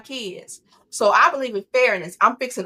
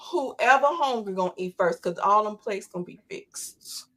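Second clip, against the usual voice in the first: ordinary delivery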